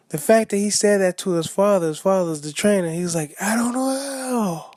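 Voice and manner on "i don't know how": mock yell. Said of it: "he yelled, he yelled it out".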